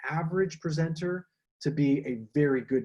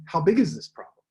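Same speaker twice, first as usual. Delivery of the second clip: posed audience question; 3 semitones higher, 6 dB louder than usual. Shown as a demonstration. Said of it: "you might be asking".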